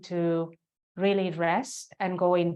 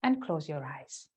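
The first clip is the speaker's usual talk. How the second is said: guided meditation. Real, not acted. Yoga teacher guiding students into the heart center, guided meditation. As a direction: lead them inward, leaving space after each phrase.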